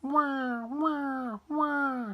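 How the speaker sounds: high imitated baby cry; acted infant wailing; long high wahs of a crying baby; bawling baby wail. A narrator's reading. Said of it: "bawling her head off".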